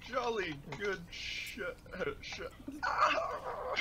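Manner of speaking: slow pompous British bluster; pompous sputtering colonel. Acted. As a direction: splutter like an old colonel.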